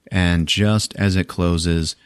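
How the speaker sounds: ordinary delivery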